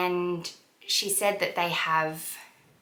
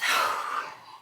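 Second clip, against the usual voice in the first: deep breath out. Real, not acted. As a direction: let the breath go slowly. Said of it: "I need to take a deep breath".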